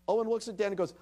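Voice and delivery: normal talking voice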